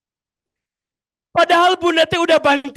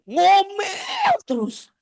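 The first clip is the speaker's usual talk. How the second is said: mock nagging; 8 semitones higher, much slower than usual. Mocking nagging mother.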